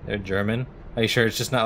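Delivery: ordinary talk, nothing marked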